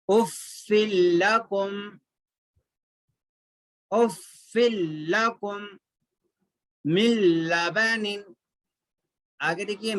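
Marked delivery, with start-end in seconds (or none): 0.1-8.2 उफ़्फ़िल लकुम measured, with held double l; merged lam recitation. Shown as a demonstration. Teacher modelling further examples of the rule, merged lam. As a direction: recite मिन लबनिन as mil-labanin, n merged into l. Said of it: "लाम और रा से मिला के पढ़ना है".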